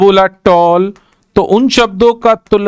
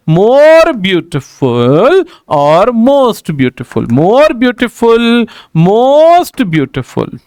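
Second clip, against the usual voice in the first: "more" and "most" stretched long before "beautiful"; slow teacher's drill, dragging out "more" and "most"; drawn-out demonstration; much slower than usual. Shown as a demonstration. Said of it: "दो बार movement लेकर आता है". ("more" और "most" को खूब खींचकर बोलें)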